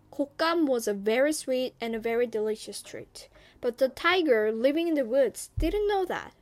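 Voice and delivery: usual speaking voice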